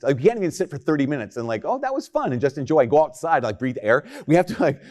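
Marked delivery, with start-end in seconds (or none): 1.6-2.3 much higher, airy viewer voice; light mock delight. Viewer content after one episode, high mock delight. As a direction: lift it high, lightly pleased.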